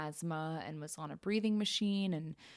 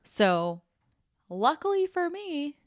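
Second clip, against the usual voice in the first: sarcastic; 9 semitones higher, 7 dB louder, slower than usual. Real, not acted.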